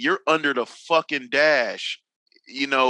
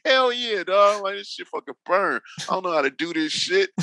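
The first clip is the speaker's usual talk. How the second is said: higher-pitched mock exasperation; retold anger; 7 semitones higher than usual. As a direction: replay being mad at a buddy, pitched up. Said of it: "Like, you really mad?".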